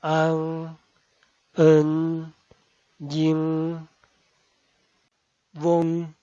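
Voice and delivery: an en yin won yang yon, each drawn out with the nasal held; Mandarin nasal finals. Shown as a demonstration. Teacher modelling Mandarin nasal finals for Spanish learners. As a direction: model each Mandarin syllable slowly for the class. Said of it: "vocales nasal traseras son".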